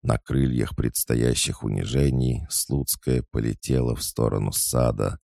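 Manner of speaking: plain reading voice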